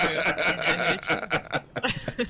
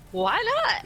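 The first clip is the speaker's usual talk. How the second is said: eager why not; 12 semitones higher than usual. Retold or imitated. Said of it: "I was like".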